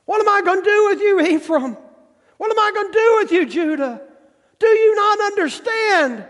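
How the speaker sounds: very high, fast, exasperated pleading; God pleading with Israel, Ephraim and Judah; God crying out. Acted. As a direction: throw up your hands and plead. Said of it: "That's what God is saying".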